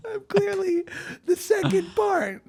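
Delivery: high, laughing self-mockery; cracking up at himself. Real, not acted. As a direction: squeal it through laughter. Laughing at his own joke.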